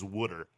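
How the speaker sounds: WOODER for water, Philly style; Philly pronunciation. Shown as a demonstration. Host showing the Philly accent, WOODER with an oo. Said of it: "all you have to do is say".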